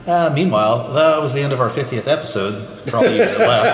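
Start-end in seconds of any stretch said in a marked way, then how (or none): 2.9-3.7 laughing. Real, not acted. Mock sign-off, laughing.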